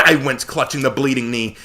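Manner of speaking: usual speaking voice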